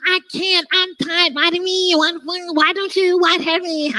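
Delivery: high, whiny complaint; whining. Retold or imitated. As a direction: whine like a tired child. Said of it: "If you whine about anything".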